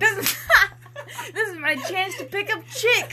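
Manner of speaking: high laughing mockery; mock it while laughing. Real, not acted. Mocking the line while laughing.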